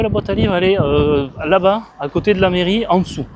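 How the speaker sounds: Sylvain Augier. Retold or imitated. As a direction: imitate a local pointing the way, hesitantly. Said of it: "C'était Sylvain Augier". (imitation enjouée, voix haute et forte)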